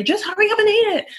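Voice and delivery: mock impatient parent, high and fast; heightened pitch and volume. Parent hurrying a child at the dinner table, sharp high rushed tone. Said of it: "heightened, like, pitch and tone of voice and volume".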